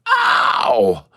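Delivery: playful singer's yelp; falsetto whoop. Real, not acted. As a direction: let out a sharp whoop to cap the song. Whoop ending the song.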